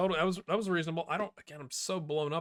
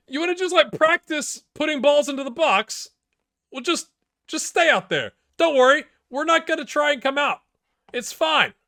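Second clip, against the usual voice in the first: high, loud sarcastic rant; mock-reassure them at the top of your voice; sarcastic exasperation; 12 semitones higher, 10 dB louder than usual. Real, not acted. Sarcastic rant at own defenders.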